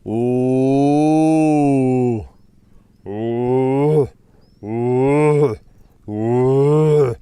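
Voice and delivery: loud, hooting mock lion roars; lion roar impression. Keeper doing his best lion roar for viewers, loud mock roar. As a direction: belt out a series of booming roar calls, hammed-up. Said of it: "I'm gonna try and do my best Suja impersonation".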